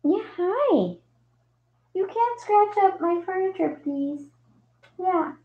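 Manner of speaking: high, soft pet-talk voice; small high voice for a kitty. Real, not acted. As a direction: coo a gentle scolding at the cat, high and soft. Talks to the cat.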